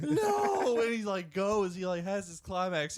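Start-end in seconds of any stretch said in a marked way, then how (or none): 0.0-0.8 very high, loud drawn-out mock wail; hammed-up sobbing cry. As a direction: howl it in comic anguish. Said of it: "he starts crying".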